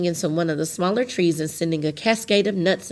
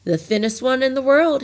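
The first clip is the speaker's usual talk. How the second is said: bright and a little higher; brightly; 7 semitones higher, 3 dB louder than usual. A narrator's reading. Thin man answering Tok's guess, brightly. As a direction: toss it off brightly, pleased with himself. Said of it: "he replied brightly".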